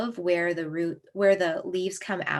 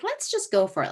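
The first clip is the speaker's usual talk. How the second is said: high, gung-ho burst; eager resolve; 12 semitones higher than usual. Retold or imitated. Speaker about to brew her first vervain tea, high eager burst. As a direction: dive in on a whim.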